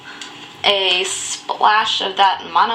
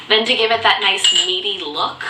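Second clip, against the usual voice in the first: usual speaking voice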